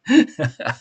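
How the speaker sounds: loud amused laugh; burst of laughter. Real, not acted. Amused by the tweet.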